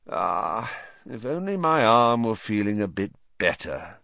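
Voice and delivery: affected, drawn sigh into speech; put-on wistful sighing. A narrator's reading. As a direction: sigh it with smug, mock regret. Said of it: "he sighed".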